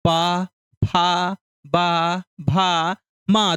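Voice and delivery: पा, फा, बा, भा, मा spaced out slowly, lips pressing together; lip sounds demo. Shown as a demonstration. Teacher has children repeat the lip consonants slowly. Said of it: "कहिए मेरे साथ".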